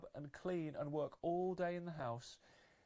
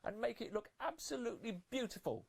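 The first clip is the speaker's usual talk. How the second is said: high, louder haughty stepsister voice; high bossy stepsister voice; 9 semitones higher, 4 dB louder than usual. Acted. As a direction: command her like a pampered mean sister. Stepsister Gloria giving orders.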